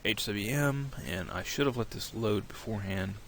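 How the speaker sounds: ordinary talk, nothing marked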